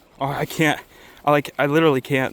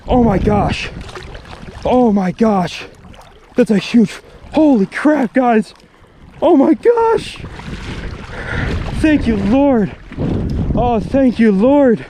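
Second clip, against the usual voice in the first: loud, much higher cries of joy after landing it; ecstatic shouting; 8 semitones higher, 4 dB louder, slower than usual. Real, not acted. Angler shouting to viewers over a landed trout, ecstatic. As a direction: whoop it out, overjoyed and stunned. Finally lands the huge trout.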